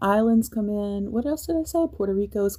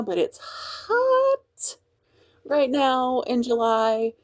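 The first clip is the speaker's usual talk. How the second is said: much higher, very slow stretched-out hot; exaggerated emphasis; 5 semitones higher, much slower than usual. Real, not acted. Telling viewers how hot Louisiana is, dragging the words out high and slow. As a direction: drag out hot like it's unbearable. Complaining about Louisiana heat.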